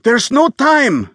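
high, loud, slow pleading shout; desperate yell. Acted. Bleeding visitor begging Bernard to open up, frantic yell. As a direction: yell it drawn out in panic. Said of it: "the visitor yelled".

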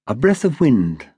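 ordinary delivery, nothing marked

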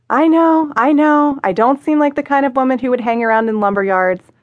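high, quick, flustered I know I know; embarrassed narrator rushing to explain herself; flustered excitement. Acted. Flustered explaining her job.

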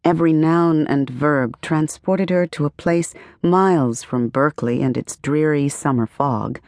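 plain reading voice

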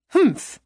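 short high huff; snort. Acted. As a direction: scoff with a snort. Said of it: "Lindsay snorted".

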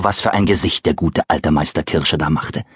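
usual speaking voice

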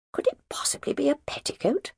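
hushed, shocked inner question; whispered thought. Acted. Margaret privately shocked at the scarlet silk, whispering. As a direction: whisper it to yourself in disbelief. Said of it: "Margaret wondered".

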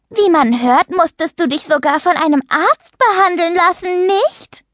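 high, falsely sweet needling; smiling, mock-innocent jibe in a high voice; sanctimonious taunting. A narrator's reading. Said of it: "Julia lächelte scheinheilig".

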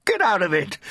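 high, urgent yell. A narrator's reading. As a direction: yell it to scare off an animal. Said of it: "he yelled as the animal".

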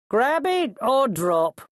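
high, slow shouted threat; called ultimatum. A narrator's reading. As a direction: call the choice down to someone dangling. Threat called to dangling thief.

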